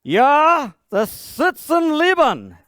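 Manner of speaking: hammed-up German accent. Shown as a demonstration. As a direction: lay on a thick German accent for laughs. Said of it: "Is that better?".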